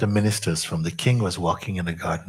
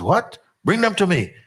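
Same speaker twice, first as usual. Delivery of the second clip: angry royal order; 12 semitones higher, 5 dB louder than usual. Retold or imitated. King hears queen's betrayal.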